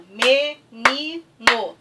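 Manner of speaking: "Menino" loud and slow, me-ni-no; syllable by syllable. Shown as a demonstration. Teacher showing the clapping trick to pupils, syllable by syllable. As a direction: punch "Menino" out in three clapped beats. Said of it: "recursos da, da, da palminha".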